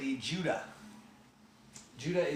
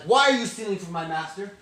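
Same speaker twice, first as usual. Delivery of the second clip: loud, high-pitched confrontation; angry accusation; 8 semitones higher, 11 dB louder than usual. Acted. Steward catches the brothers stealing, angry accusation. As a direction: shout them down in outrage.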